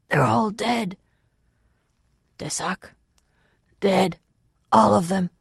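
strained, high, broken-up phrases; hoarse whisper. A narrator's reading. Fugitive reporting the deaths to the Waylord, hoarse whisper. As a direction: force out each phrase hoarsely, with gaps. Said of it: "in a hoarse whisper".

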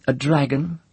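a forced, high scoffing question; a girl's mock scorn that doesn't quite land; trying to scoff. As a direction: sneer at it, but without conviction. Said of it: "trying to scoff".

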